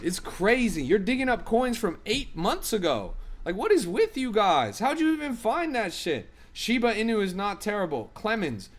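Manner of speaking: venting at chat in disbelief; worked up. Real, not acted. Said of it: "I'm getting worked up right now".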